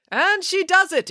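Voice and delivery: high-pitched race caller's triumph; excited exclamation. A narrator's reading. Mister Lang announces the race winner, high excited exclamation. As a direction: call the win, pitched right up. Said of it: "exclaimed Mister Lang".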